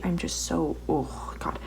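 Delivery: whispered, worn-out sigh of words; exasperated whisper. Real, not acted. Vlogger whispering her frustration at the packed room. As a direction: breathe it out drained.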